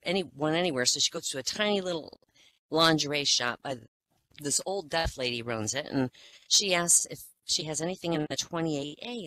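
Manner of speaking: ordinary talk, nothing marked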